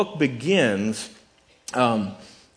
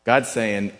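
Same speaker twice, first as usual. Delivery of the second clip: ordinary talk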